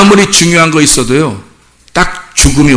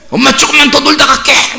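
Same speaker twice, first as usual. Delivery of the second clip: put-on high-pitched teen begging for more playtime; pleading child; 10 semitones higher than usual. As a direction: imitate a kid wheedling a parent. Acting teen begging to stay out. (더 놀겠다고 조르는 아이 흉내)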